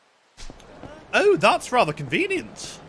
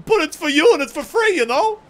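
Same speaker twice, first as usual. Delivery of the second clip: higher, louder goofy clown pitch; 8 semitones higher, 6 dB louder than usual. Acted. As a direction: sell it like a silly clown. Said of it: "That's a clown voice right there".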